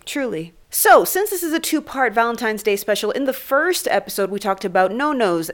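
ordinary delivery

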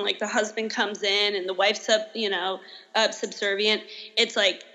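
ordinary talk, nothing marked

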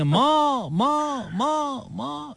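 fading echoing call. Retold or imitated. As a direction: echo dying away. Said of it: "और आगे से आवाज आती है".

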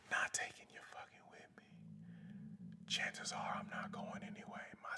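a near-silent whisper; whispered sign-off aside. Real, not acted. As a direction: drop to a breathy whisper. Signing off, drops voice.

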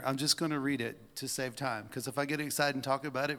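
usual speaking voice

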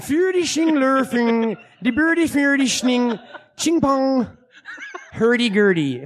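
Swedish-chef gibberish with laughs breaking in. Pretend. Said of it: "like a Chinese Swedish chef".

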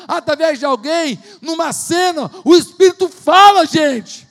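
loud rising preacher's cry, hammering each phrase; preaching at full force. Acted. Preacher crying out to the congregation, loud and rising. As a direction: build the sermon up to a shout.

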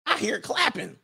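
high, lightly suspicious mother voice; suspicious mama. Acted. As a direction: play the nosy mama, pitched high. Acting out a suspicious parent.

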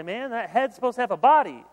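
loud mock exclamation; quoting Paul. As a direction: call it out like Paul would. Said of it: "Paul would say".

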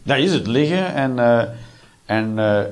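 ordinary talk

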